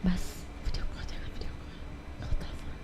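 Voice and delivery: hushed whisper; whispered warning. Retold or imitated. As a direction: quietly stop the tourist filming. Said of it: "Dia bilang".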